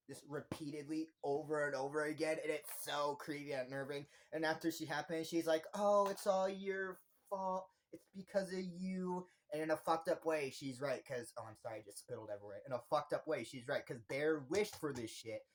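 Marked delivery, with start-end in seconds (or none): 5.7-9.3 high, drawn-out accusing lament; acted blaming. Nikki blaming Bear after the killing, accusing. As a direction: imitate slow, distressed blame.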